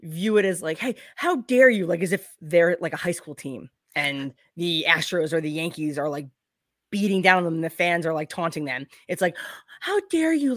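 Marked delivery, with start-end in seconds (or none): 0.8-1.9 high, indignant mock fan voice; mock offended fan. Mocking whiny fans who say how dare you. 9.8-10.5 much higher mock-indignant squeal; mock offended fan. Mimicking fans crying how dare you at critics. Mocking touchy underdog fans.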